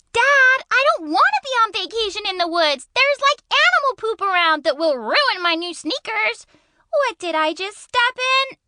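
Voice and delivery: put-on high American teen whining to Dad; complaining teen girl, high and dramatic; feisty teenage girl. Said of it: "One is a feisty teenage girl".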